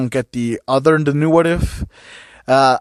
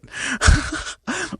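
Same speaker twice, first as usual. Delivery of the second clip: high giggle; 14 semitones higher than usual. Real, not acted. Laughing at own rant.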